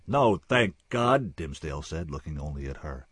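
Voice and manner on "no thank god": defiant drawl. Acted. Intruder answers defiantly.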